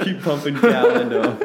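laughing through a teasing jab; cracking up as you rib him; laughing tease. Real, not acted. Teasing co-host while laughing.

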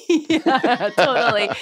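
laughing through a high yes; giggling agreement, pitch up. Real, not acted. Laughing along as the host teases her about medals.

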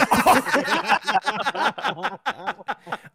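loud disbelieving laugh. Real, not acted.

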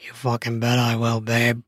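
low, teasing male voice; dominant man. Acted. Man in dom mode holding his partner back, low. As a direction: hold her back, low and in control.

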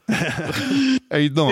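very slow, lazy tough-guy drawl; Sly Stallone impression. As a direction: drag out a Stallone greeting. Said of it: "that's Sly Stallone".

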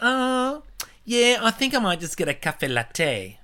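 high, slow affected voice; posh mock voice. Deb ordering coffee, suddenly high and affected. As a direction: put on a fancy high voice for "cafe latte". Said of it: "And she goes".

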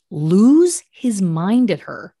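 normal talking voice

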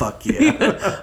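high laughing yelp of yes; mock eager yes. Thrilled high yelp answering the whispered request. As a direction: squeal it like a thrilled partner.

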